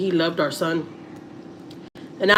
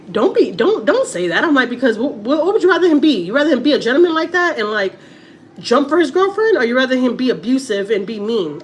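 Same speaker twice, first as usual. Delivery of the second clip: heated retold protest; 6 semitones higher, 4 dB louder than usual. Retold arguing with husband.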